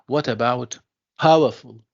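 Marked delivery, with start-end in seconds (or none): 1.2-1.8 "powerful" with POW punched and FUL light; stress on first syllable. Shown as a demonstration. Teacher quizzing students, "powerful" with strong stress on POW. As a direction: hit POW in "powerful", let the ending drop. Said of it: "the stress is on the first syllable".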